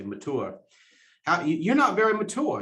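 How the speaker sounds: mature said as ma-TOO-uh, the r dropped; imitating the teacher's mature as ma-TOO-uh; mocking mature as ma-TOO-uh with no r; teacher's mispronunciation. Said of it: "she mispronounced it".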